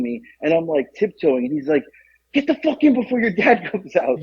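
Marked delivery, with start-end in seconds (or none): 2.3-4.2 higher-pitched mock urgency; hustling someone inside, half-amused; urgent retold order. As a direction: imitate an exasperated friend hurrying someone indoors. Friend urging her indoors, retold.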